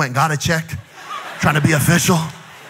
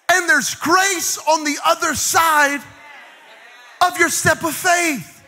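full-force preaching; 10 semitones higher than usual. Acted. Preacher shouting to the church, full force. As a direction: drive it home to the congregation.

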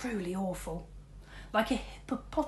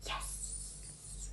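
excited whisper; 12 dB quieter than usual. A narrator's reading. Rat hits the ramp.

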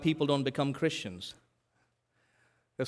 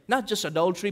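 ordinary delivery

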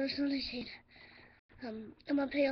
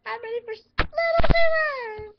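excited sing-song; 10 semitones higher, 14 dB louder than usual. Real, not acted. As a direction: belt out the name of the pizza place. Pizza has arrived.

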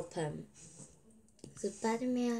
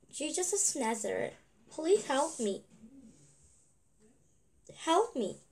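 higher-pitched, urgent cry for help; gritado; 6 semitones higher, faster than usual. Acted. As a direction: call out, pitched high, pleading for help. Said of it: "so he shouted".

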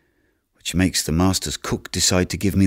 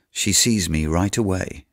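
ordinary delivery

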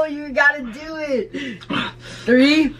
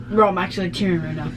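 ordinary talk

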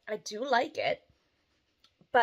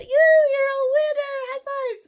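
high, loud cheer; excited praise; 9 dB louder than usual. Real, not acted. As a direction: cheer her on big. Cheering daughter after right guess.

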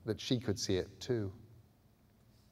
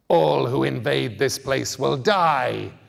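slow, much louder ancient roar; guttural shout; 3 semitones higher, 10 dB louder, slower than usual. A narrator's reading. Indigo man shouting at the intruders, slow guttural roar. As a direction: bellow the threat like a war cry. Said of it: "he shouted in his guttural speech".

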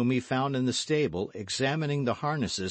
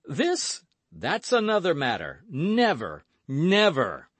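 high, loud indignant refusal; shocked, raised-voice protest; adamant refusal; 7 semitones higher, 6 dB louder than usual. A narrator's reading. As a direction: refuse outright, startled and emphatic. D'Artagnan refuses to stake diamond.

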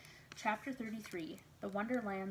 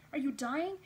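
high, louder urgent question; alarmed question; 6 semitones higher, 5 dB louder than usual. A narrator's reading. Quincy running to the screamer's side, alarmed question. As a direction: ask it in rising alarm. Alarmed helper runs over.

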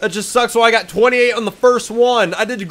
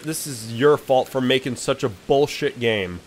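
ordinary talk, nothing marked

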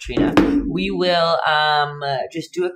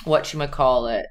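ordinary talk

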